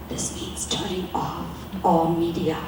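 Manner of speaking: whispered aside, no voice. Real, not acted. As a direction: breathe it as a secret. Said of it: "whispered aside".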